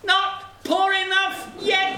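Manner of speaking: very high, drawn-out bird taunt; taunting sparrow. A narrator's reading. Sparrow taunting over the dead horse, high and relentless. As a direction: ring it out high and slow.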